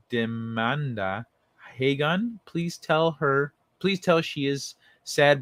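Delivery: usual speaking voice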